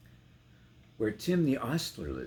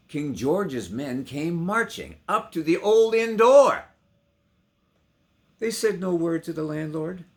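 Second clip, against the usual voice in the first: loud declaiming; 10 semitones higher, 12 dB louder than usual. A narrator's reading. Soldiers arrive, declaimed.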